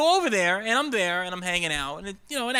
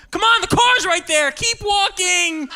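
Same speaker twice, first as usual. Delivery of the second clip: high, loud yell at a straggling friend; acted high-pitched urging, words stretched at the end; urging shout; 10 semitones higher, 5 dB louder, slower than usual. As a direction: holler them onward. Urging a drunk friend home at night.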